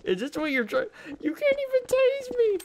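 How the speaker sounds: squeaky falsetto taunt; taunting officers in a shrill cartoon squeak, mock squeak; mock high squeak. Teasing cops who cannot tase him, high squeaky taunt.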